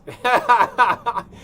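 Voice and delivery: big burst of laughter, high and loud. Real, not acted. Painter laughing loudly at his own joke about smoking.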